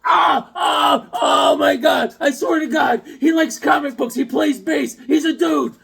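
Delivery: high, shouted frantic self-defence; mock panic. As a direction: plead your innocence at full pitch, hammed-up. Panicked defence to jealous wife.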